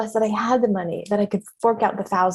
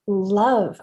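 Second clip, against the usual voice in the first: normal talking voice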